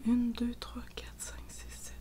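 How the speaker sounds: whispering the stitch count under her breath; chuchoté en comptant. Real, not acted. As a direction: count the stitches quietly to yourself. Compte ses mailles à voix basse. (compter les mailles en chuchotant)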